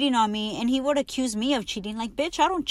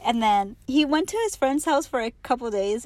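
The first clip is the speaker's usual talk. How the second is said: ordinary talk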